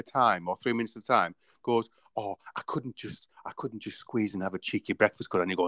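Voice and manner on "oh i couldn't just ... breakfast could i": soft pleading customer voice; wheedling customer. Retold or imitated. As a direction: beg sweetly for breakfast. Customer voiced in retold scene.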